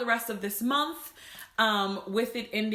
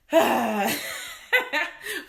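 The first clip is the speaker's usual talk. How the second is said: a teary, laughing sigh; holding back tears with a sigh; shaky sigh; 6 semitones higher, 5 dB louder than usual. Real, not acted. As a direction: breathe out through rising tears. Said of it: "So I'm trying not to cry".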